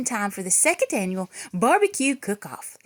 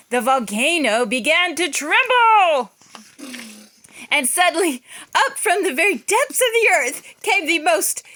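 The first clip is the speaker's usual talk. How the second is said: louder and higher, slowing toward the reveal; building suspense; 6 semitones higher, 5 dB louder than usual. A narrator's reading. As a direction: build it up to the monster's name. Narrator builds to monster reveal.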